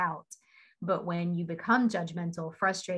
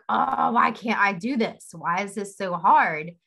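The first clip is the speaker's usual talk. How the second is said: frustrated outburst; 3 semitones higher, 8 dB louder than usual. Retold or imitated. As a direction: vent her frustration with herself, half acted. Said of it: "really frustrated".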